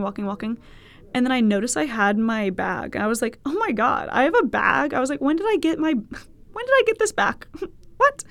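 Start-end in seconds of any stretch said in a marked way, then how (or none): 3.5-8.2 high, incredulous questions breaking into laughs; astonished and giggly, voice jumping up; retold bewilderment. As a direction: relive the baffled discovery, pitch climbing. Retold bewilderment on finding the bag.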